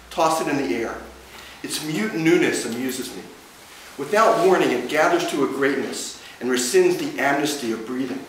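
deliberate recital with pauses between images; poetry reading. Acted. Poet reading a poem called Pain to an audience, deliberate recital. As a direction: read each line as verse, phrase by phrase.

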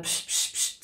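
whispered shush; quick unvoiced psst to silence someone; whispered psst, imitating the crowd. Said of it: "wenn jemand".

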